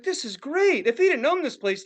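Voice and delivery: high-pitched mock thrill; delighted aside. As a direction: imitate giddy amazement at the scene. Acts out own delighted thought.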